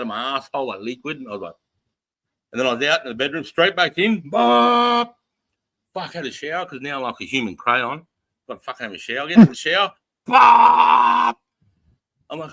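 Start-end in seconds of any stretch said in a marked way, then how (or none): 4.3-5.0 a loud, sudden burst of sound imitating a violent purge; mock explosion noise. Mimicking second toilet rush. 10.2-11.3 a high, loud, shouted blast of noise; mock explosion shout. Mimicking toilet rush in shower.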